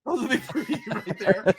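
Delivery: laughing while repeating it. Real, not acted. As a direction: echo it through a laugh. Echoes it laughing.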